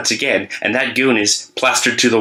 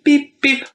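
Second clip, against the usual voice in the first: playful truck beep noise; imitated beeping.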